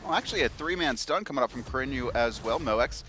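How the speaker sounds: ordinary talk, nothing marked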